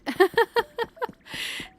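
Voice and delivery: bright squealing giggle, very high; giddy high laugh. Real, not acted. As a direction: let a high giggle burst out. Giggle before the excited repeat.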